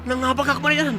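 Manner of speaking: triumphant shout. Acted. [name] shouting to Marian that he won, triumphant yell. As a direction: shout with glee at the win. [name] winning while driving.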